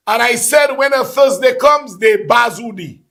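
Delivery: ordinary talk, nothing marked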